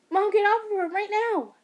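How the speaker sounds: loud, high furious order; angry shout. Acted. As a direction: yell at her to stop. Son pulling mother off.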